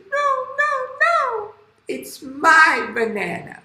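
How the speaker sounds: very high, squealed protest; squeaky little monkey, stretching the claim out; Sister Monkey. Acted. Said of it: "Sister Monkey said".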